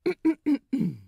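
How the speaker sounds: loud, showy throat clear; dramatic throat clearing. A narrator's reading. Captain before story time, theatrical throat clearing. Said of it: "dramatically clears his throat".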